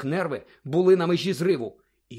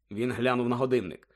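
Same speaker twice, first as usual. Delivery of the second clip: ordinary delivery, nothing marked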